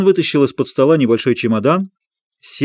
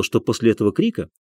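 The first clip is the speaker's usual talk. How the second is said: plain reading voice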